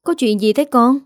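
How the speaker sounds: mildly anxious mother's voice; worried. A narrator's reading. Mother on the phone to her daughter, mildly worried. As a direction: ask with a touch of worry. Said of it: "giọng má bên kia lo lắng".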